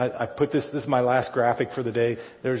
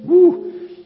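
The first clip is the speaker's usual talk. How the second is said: loud high whoop; excited whoop; 14 dB louder than usual. Real, not acted. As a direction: let out a big whoop. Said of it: "getting my boomy God voice on".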